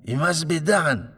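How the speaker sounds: superstitious landlady crying out in fear; frightened foreign landlady. Acted. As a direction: plead in a panic to stop him opening the door. Laristi panics at the door.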